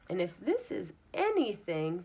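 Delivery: ordinary talk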